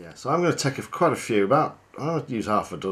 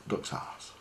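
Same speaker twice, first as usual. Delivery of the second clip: cheeky whisper; 10 dB quieter than usual. Acted. Cheeky reveal after clearing throat.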